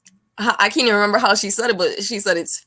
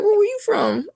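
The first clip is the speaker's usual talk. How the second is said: much higher mock question; curious local. Southerners asking where she is from, mock high. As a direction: mimic the puzzled Southerner. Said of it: "you be like".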